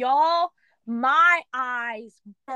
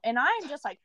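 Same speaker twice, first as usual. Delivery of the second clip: ordinary delivery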